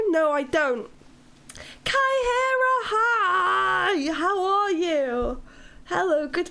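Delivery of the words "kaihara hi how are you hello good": high, squealing hello, slow on how are you; excited greeting. Real, not acted. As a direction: greet them like a long-lost friend. Greeting a viewer on stream.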